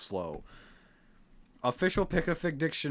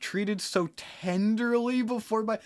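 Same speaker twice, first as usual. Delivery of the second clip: higher, sarcastic recital; higher mock-swoony quote; mocking the prose; 10 semitones higher than usual. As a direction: sneer at the line by parroting it. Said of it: "quoting the chapter".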